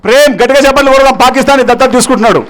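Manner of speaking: loud, high announcement to the crowd; calling for applause. Real, not acted. Speaker announces a volunteer's pledge to the audience, rallying loudly.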